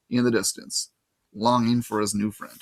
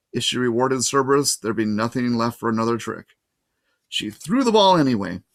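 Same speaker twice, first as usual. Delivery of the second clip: ordinary delivery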